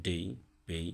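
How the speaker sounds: ডেই and পেই said with an English ay vowel; পেই with a clear ay; English letter A sound. Shown as a demonstration. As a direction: say ডেই like day. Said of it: "এই উচ্চারণ হবে এর".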